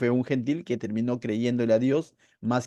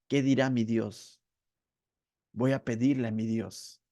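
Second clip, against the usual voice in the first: intimate; 4 semitones lower than usual. Acted. Believer asking what his own God will say, intimate. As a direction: speak of God as your own. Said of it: "habla de una relación mucho más íntima".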